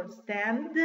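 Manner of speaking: "Stand" ending in a released D; audible final D. Shown as a demonstration. Teacher showing class the sound, "Stand" with audible D. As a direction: let the D at the end of "Stand" be heard. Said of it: "que se escuche la D".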